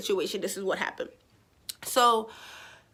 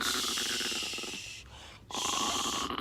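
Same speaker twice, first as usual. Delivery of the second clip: whispered shushing, far lower; hushing whisper; 12 semitones lower, 10 dB quieter than usual. Real, not acted. Owner whispers to quiet her restless dog.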